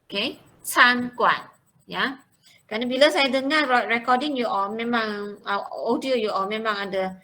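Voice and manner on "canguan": "canguan" drawn out slowly and clearly; slow model pronunciation. Shown as a demonstration. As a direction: repeat "canguan" slowly for the class. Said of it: "kurang tepat". (sebut "canguan" perlahan-lahan)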